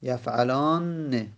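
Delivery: یفعلان said very slowly, the doubled nn held before the final e; slow doubled nun. Shown as a demonstration. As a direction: drag out یفعلان, hold the doubled nn. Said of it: "نون تشدید دار میاریم".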